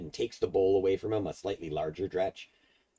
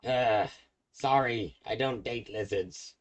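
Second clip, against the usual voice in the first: lightly voiced hag, dismissive and a bit higher; hag brushing off; 5 semitones higher, slower than usual. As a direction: turn him down like a sniffy old hag. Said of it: "and she's like".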